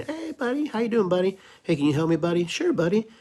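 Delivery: high, chummy mock buddy voice; mock buddy talk. Mocking political cronies calling each other buddy, high chummy voice. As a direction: play the cronies trading favors. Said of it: "It's all the buddy, buddy".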